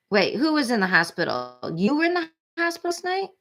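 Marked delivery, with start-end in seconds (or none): 1.7-3.3 startled question. Real, not acted. Reacting to chat news.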